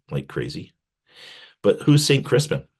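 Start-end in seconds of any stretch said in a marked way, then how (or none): none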